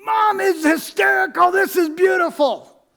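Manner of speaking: put-on high, loud delighted kid voice; kids' excited cries. Kids cheering about mom on the coaster, high and loud. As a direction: cheer like giddy children on a ride. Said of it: "My kids are going".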